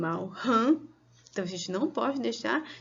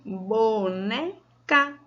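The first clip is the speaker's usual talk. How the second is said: slow, split into "bo-ne-ca"; syllable by syllable; much slower than usual. Shown as a demonstration. Teacher counting syllables for children, syllable by syllable. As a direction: draw out each syllable of "boneca" with gaps. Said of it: "Você levantou quantas vezes o seu dedinho".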